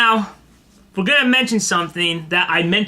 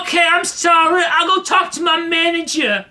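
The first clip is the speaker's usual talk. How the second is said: apologizing bus passenger; 10 semitones higher than usual. Retold or imitated. Bus passenger answers the driver, high hollered apology. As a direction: mimic a man hollering his apology. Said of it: "He's just like".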